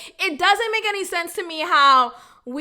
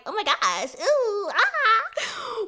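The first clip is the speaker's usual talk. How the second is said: scandalized; 6 semitones higher, much slower than usual. Retold or imitated. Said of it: "a little bit of me that's like".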